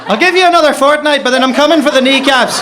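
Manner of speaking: loud driver's telling-off. Acted. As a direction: scold him loudly as the driver. Driver scolding crying passenger, retold.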